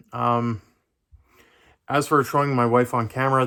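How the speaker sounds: ordinary delivery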